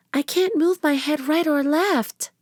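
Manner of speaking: put-on high young girl voice; a little girl complaining. A narrator's reading. Narrator voices Annie.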